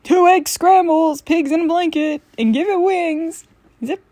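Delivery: waitress hollering an order through the hatch; diner order call. Acted. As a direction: bellow the order across the diner. Waitress calling order to kitchen.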